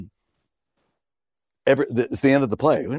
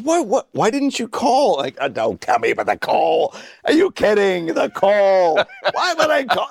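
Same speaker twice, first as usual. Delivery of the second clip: high, laughing mock disbelief; mock incredulous; 9 semitones higher than usual. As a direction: play up the outrage at being asked. Acting his incredulous reaction backstage.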